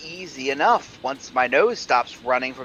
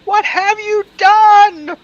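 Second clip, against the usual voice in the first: high, loud, slow cry; panicked shout; 13 dB louder, slower than usual. Acted. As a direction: yell it over the storm. Blown off feet by wind.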